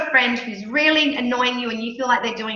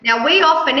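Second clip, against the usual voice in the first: normal talking voice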